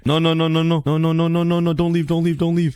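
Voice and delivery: frantic repeated pleading; desperate pleading. Real, not acted. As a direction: beg the piglin to stay. Pleading piglin not to leave.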